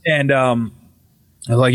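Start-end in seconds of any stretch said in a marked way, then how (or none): none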